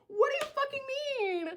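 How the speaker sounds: very high, soft squeal; held-back high wail of disbelief; strangled disbelief. Retold or imitated. Same reaction, strangled and quiet.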